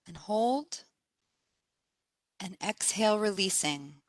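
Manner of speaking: soft, unhurried meditation voice with spaced phrases; guided meditation. Acted. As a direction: guide them gently into relaxation, pausing between phrases.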